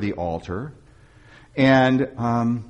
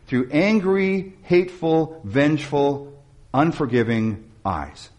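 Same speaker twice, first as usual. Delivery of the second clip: each word set apart slowly; slow emphatic list; slower than usual. Real, not acted. Preacher describing God's eyes, slowly, word by word. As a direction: drag out the list of harsh words. Listing God's harsh gaze.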